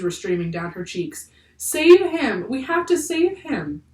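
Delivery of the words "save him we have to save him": high, pleading, drawn-out cry; desperate cry. A narrator's reading. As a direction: beg urgently through tears. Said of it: "cried Annie".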